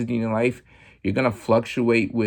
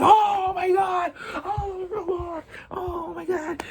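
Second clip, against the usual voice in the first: very high, slow, strained oh my God; stunned hype; 3 dB quieter, slower than usual. Retold or imitated. DJs reacting to the slavery line, squealing high. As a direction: squeal in disbelief. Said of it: "And they're like".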